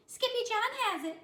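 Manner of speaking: put-on high, loud girls' voice; sisters voice. A narrator's reading. Sisters telling Mama about the ladder, high tattling. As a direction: tell on the brother.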